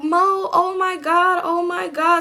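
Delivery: very high, slow, squealing half-cry; squealing little sisters. Retold or imitated. Sisters squealing over the phone in a high, excited voice. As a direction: squeal it like thrilled little girls.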